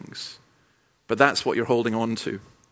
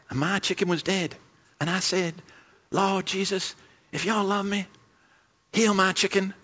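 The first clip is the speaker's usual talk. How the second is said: higher southern US mock prayer; hammed-up southern US testimony; southern US accent; 5 semitones higher, 4 dB quieter than usual. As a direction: plead for a miracle in a put-on American South accent. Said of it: "had this southern U S accent".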